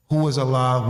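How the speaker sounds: usual speaking voice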